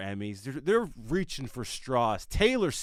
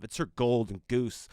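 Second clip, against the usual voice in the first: ordinary delivery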